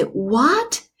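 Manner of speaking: "What" sweeping up high; rising tone. Shown as a demonstration. Teacher showing second tone, "What" rising in disbelief. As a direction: ask an incredulous "What" with a steep rise. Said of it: "your tone goes up".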